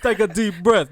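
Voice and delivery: loud, high mimicry of a meditation guide; mocking imitation. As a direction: parrot the guide's line teasingly. Mimicking friend's meditation line.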